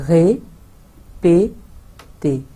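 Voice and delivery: रे, पे, ते, separate beats with pauses; split into syllables. Shown as a demonstration. Teacher chopping répéter into syllables. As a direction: break répéter into three syllables. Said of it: "syllables में तोड़ के बोलते हैं".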